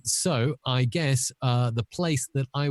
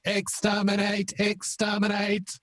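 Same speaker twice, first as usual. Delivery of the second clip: high robotic monotone; effected Dalek war cry; 10 semitones higher than usual. Acted. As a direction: chant it like an electronic Dalek. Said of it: "this is the Dalek Bond villain".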